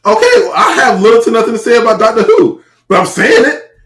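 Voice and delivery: pushy, high-pitched rebuttal; exasperated retort. Real, not acted. Heated back-and-forth over shows.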